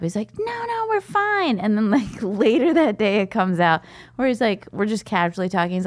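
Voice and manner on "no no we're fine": high, innocent reassurance; squeaky denial from the dad; mock dad voice.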